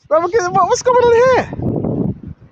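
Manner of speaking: high, drawn-out disbelief; mock astonished asking; incredulous question. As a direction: stretch it out, baffled. Repeats the imagined question.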